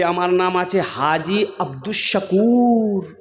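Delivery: slow, low introduction with drawn-out name; self-important Haji. Retold or imitated. Haji tells a worshipper his name, self-important. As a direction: stretch out the name pompously, pitched low.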